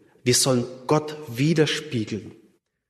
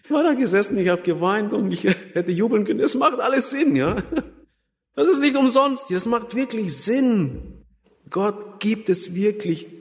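laughing, pitched way up with overflowing joy; reliving the moment of joy, breaking into laughter; joyful laughter; 7 semitones higher than usual. Retold or imitated. Preacher tells the congregation of his conversion, laughing joyfully.

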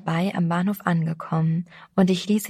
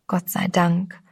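ordinary delivery

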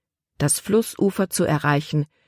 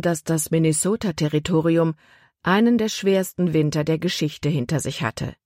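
ordinary delivery

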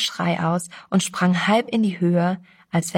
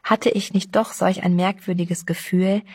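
ordinary delivery, nothing marked

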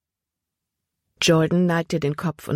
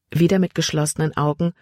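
ordinary delivery, nothing marked

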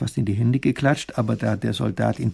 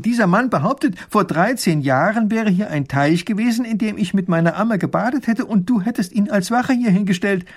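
higher, louder excited telling; excited princess; 8 semitones higher, 5 dB louder than usual. Acted. Princess excitedly tells her father about the soldier. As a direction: tumble it out to father.

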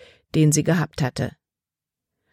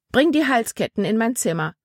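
higher-pitched order, falling at the end; commanding; 6 semitones higher than usual. A narrator's reading. Melanie sends the girl off with necklaces, commanding. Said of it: "befahl Melanie".

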